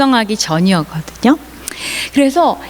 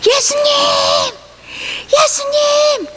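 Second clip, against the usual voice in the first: very high, drawn-out acted call; high-pitched calling out without real volume; crying out. Blind beggar calling Jesus over a crowd, high cry. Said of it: "소리를 지르기 시작했어요".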